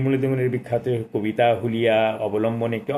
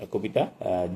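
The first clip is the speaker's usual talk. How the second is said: usual speaking voice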